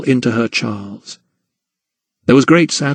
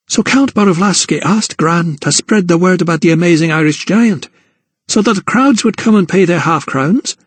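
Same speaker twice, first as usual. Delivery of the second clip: put-on higher, rising girl's voice; Emily to her gran, higher and accusing; realising Emily; 6 semitones higher than usual. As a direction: piece it together aloud. Emily works it out.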